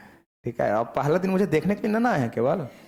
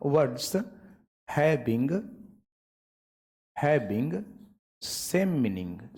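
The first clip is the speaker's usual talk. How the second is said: slow dictation; 3 dB quieter, much slower than usual. Shown as a demonstration. Teacher dictates definition while writing.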